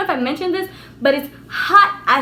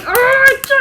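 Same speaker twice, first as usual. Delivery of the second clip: high, loud frustrated "argh"; acted-out burst of rage; angry roar; 10 semitones higher, 7 dB louder than usual. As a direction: let out a strained yell of fury. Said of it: "I was angry".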